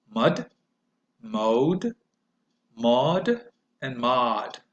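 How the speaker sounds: nut, note, not, not with the mouth opening wider; slow on the last not; vowel series demo. Shown as a demonstration. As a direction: model each vowel. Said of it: "my mouth opens wider".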